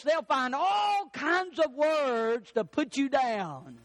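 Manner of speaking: high, slow emphatic pulpit cry; full-force preaching. Real, not acted. Preacher urging believers to point out sin, impassioned exhortation. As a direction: drive each phrase home, stretching the last words.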